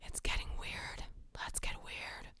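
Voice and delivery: hushed creepy whisper into the mic. Pretend. Streamer reading a creepy chat line, whispered. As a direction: breathe it like a stalker in your ear. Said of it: "A S M R for the horrible".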